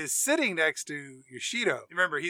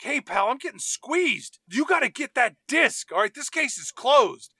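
high-pitched tough-guy impression; Harvey Keitel; 7 semitones higher, 4 dB louder than usual. Shaking down a detective, pushy mock tough guy. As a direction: lean on him like a movie heavy. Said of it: "We cut to Harvey Keitel".

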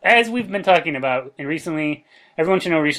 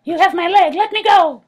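very high squeaky little creature; shrill tiny fairy shouting; 5 dB louder than usual. Acted.

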